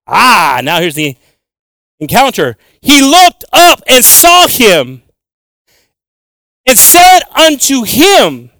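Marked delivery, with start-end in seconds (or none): 2.8-8.5 very slow, loud and emphatic reading; slow scripture reading. Shown as a demonstration. Preacher reading the encounter verse, slow and emphatic. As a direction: stretch each word of the verse out. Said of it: "I like to read the word so slow".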